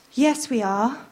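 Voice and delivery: usual speaking voice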